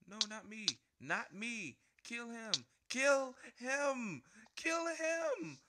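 high, loud frantic pleading; panicked begging. Real, not acted. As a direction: scream for someone else to be taken instead. Being hunted in game, begging.

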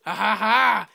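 loud taunting ha ha ha; gloating belly laugh; mocking laugh. Real, not acted. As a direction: cackle at the stuck driver.

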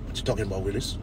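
very low, hushed catchphrase; catchphrase imitation. Reacting with Steenie to new Canadians, low hushed catchphrase imitation. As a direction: sink right down into a quiet quoted comeback. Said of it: "have to look like".

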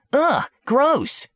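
high, squeamish "ugh, gross"; disgusted princess. Acted. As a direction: recoil from the stink like a spoiled princess. Reacts to the smell.

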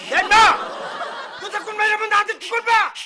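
exasperated yell down the phone; angry shout. Acted. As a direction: tell off the caller, half laughing. Yelling threats at a prank caller.

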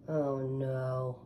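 plain reading voice

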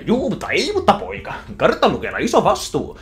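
high, loud silly dad voice; goofy cartoon dad. Dad brushing off his son's worry, high and silly. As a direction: bumble along cheerfully like a clumsy cartoon father.

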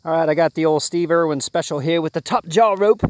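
put-on Australian accent, loud and showy; Aussie accent. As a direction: play an Aussie croc hunter. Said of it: "in my best Aussie voice".

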